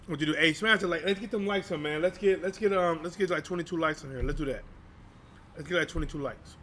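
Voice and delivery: ordinary talk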